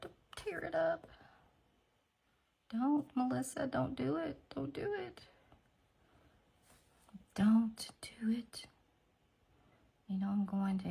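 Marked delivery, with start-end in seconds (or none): 2.7-5.2 high-pitched gentle self-warning; self-pleading. Real, not acted. Talking herself out of it. 7.3-8.7 drawn-out, weighty warning; slow, deliberate self-restraint. Real, not acted. Repeats the warning to herself.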